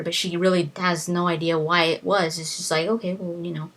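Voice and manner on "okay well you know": normal talking voice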